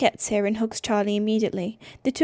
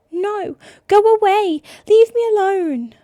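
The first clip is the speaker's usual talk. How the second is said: high, loud frantic yell; screaming; 10 semitones higher, 9 dB louder than usual. Acted. Brother screaming at his sister to leave, frantic. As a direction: drive her out with a scream.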